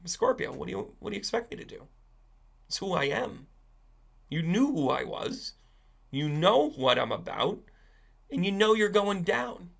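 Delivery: a hint of a scorpion excusing himself; shrugging scorpion. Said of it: "the Scorpio shrugged his shoulders and said".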